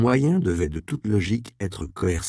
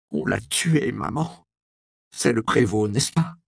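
angry accusation; 4 semitones higher than usual. Acted. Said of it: "la colère monta au front d'Alice".

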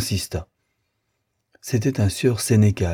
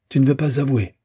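higher, insistent question; pressing demand; 6 semitones higher than usual. A narrator's reading. Frédéric demanding Rosanette admit it, insistent. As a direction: press for a confession.

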